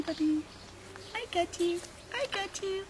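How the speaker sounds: ordinary talk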